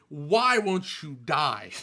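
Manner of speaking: loud, drawn-out comic wail; mock exasperated yell. Keeper yelling at the cricket that won't die, mock-exasperated. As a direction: stretch every word in hammed-up frustration.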